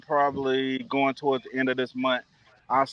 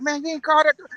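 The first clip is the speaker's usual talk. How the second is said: imitating his buddy; 12 semitones higher, faster than usual. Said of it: "He was like".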